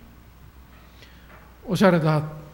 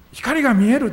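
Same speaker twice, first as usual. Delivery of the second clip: put-on a girl cries out that she sees light, shouting; retold cry of joy; 7 semitones higher, 5 dB louder than usual. 失明した少女が喜びの叫び声で. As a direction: shout 「光が見える！」 high and loud, like a girl suddenly seeing light. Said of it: "とかって叫び始めるんです".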